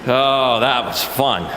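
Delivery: usual speaking voice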